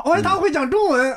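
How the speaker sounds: high, amazed gasp of delight. Retold or imitated. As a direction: marvel that he speaks Chinese. Amazed audience member.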